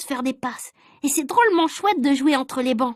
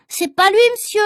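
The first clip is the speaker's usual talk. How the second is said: put-on higher, louder boy's protest; shouted protest; 6 semitones higher, 4 dB louder than usual. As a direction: call out to defend a friend. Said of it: "a crié Rufus".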